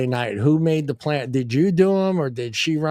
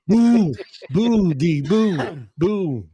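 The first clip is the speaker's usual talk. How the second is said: playful boo; 3 semitones higher, much slower than usual. Pretend. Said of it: "Everybody scared D".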